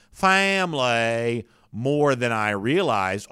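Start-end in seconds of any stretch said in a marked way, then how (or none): 0.1-1.5 slow mock Southern drawl on "family"; drawn-out fake Southern pronunciation; fake Southern accent. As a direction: imitate a botched Louisiana accent. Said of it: "he faked the accent and got it wrong".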